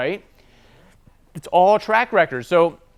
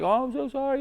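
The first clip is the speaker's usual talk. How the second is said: high, sugary fake sorry; a lightly put-on soothing apology; mock apology; 9 semitones higher, 4 dB quieter than usual. Placating an angry customer, high mock-sweet sorry.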